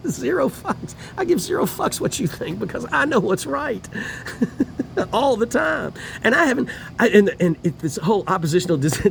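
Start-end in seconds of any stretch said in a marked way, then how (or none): none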